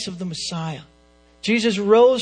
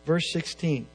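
ordinary talk

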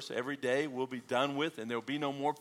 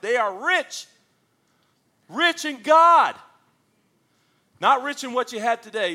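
full-force preaching; 10 semitones higher, 13 dB louder than usual. Real, not acted. Preacher proclaiming riches in God, high and loud. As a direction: ring it out to the back row. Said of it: "preaching at full force".

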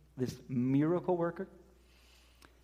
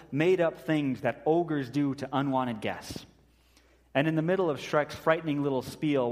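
ordinary talk, nothing marked

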